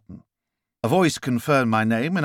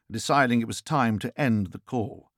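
plain reading voice

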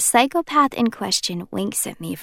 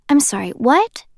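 higher, puzzled what; baffled disbelief; 7 semitones higher, 4 dB louder than usual. Acted. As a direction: caught completely off guard. Narrator confused, sudden disbelief.

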